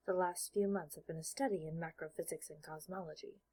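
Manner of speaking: plain reading voice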